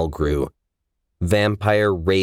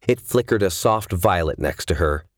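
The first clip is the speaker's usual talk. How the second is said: ordinary delivery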